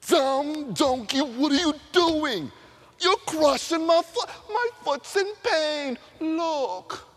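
Balaam with his foot crushed, yelling at the donkey; pained angry Balaam. Acted. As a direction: complain in hammed-up pain. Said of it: "crushed the Balaam's foot".

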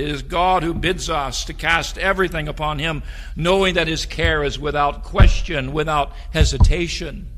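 loud, soaring pulpit voice; fiery preaching. Acted. Pastor exhorting the church about God's care: fiery preaching. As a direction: lift the congregation with full force.

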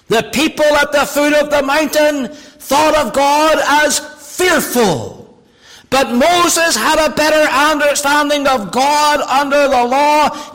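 slow, loud, rising declaration; full-force preaching. Acted. Preacher declaring Moses knew God's mercy, slow and loud. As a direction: proclaim each phrase.

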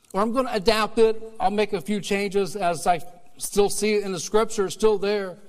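loud, high preaching; preaching at full force. Acted. Pastor preaching hard to the congregation, full force. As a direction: drive it home from the pulpit.

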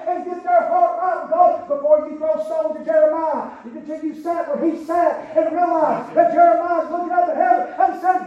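loud preaching voice; full-force preaching. Acted. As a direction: drive it home to the church. Preacher rising again.

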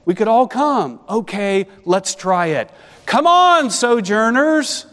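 excited welcome. Retold or imitated. Hezekiah calling the sojourners to come, excited shout. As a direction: throw the doors open with a big shout. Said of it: "But Hezekiah said".

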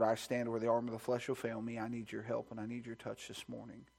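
ordinary delivery

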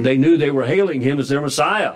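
ordinary talk, nothing marked

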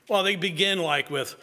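normal talking voice

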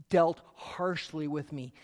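ordinary talk, nothing marked

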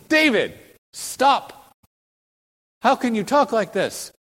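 high, loud, scandalized protest; mock outrage. Objector telling David to stop, in mock outrage. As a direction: cut him off in shocked objection.

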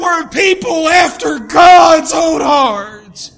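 loud, driving sermon; full-force preaching. Real, not acted. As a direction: preach it hard to the congregation.

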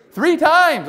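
high, loud, ringing reply; loud burst from the pulpit; emphatic answer. Real, not acted. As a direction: call the answer out forcefully. Answers his repeated question emphatically.

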